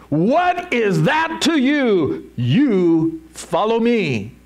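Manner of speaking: high, slow, emphatic retort; exasperated. Acted. Jesus telling Peter to mind himself, exasperated. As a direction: stretch each phrase like a fed-up parent. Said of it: "that's what Jesus is saying here".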